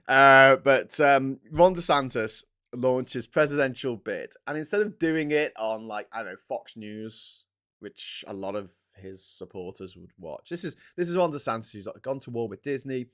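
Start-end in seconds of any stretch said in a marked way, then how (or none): none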